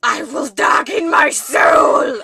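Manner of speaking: slow, booming dramatic shout; deep dark voice. Pretend. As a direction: bellow it like a brooding villain, hammed-up. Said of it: "Let's go deeper, deeper, deeper".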